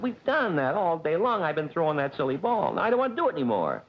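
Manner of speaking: high, whiny protest at a pet; worn-out owner refusing, voice climbing high; exasperated complaint. Retold or imitated. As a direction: plead fed up, pitch way up. Acted complaint to the dog.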